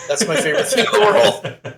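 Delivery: high, loud, breaking into laughter; laughing. Real, not acted. Fan gushing over a favourite catchphrase, laughing. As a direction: say it through real laughter.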